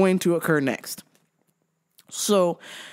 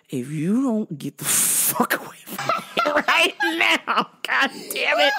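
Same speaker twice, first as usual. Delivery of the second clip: mock angry threat; 5 semitones higher than usual. Acted threat to coworker.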